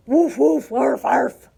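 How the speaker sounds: loud imitated dog barks; four short, gruff woofs and arfs; dog barking. A narrator's reading. Dog showing off his noise to Martina, loud barking. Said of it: "And Dog went".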